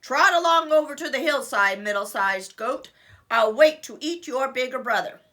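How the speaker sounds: booming greedy troll; loud troll voice. Acted. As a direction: boom it out.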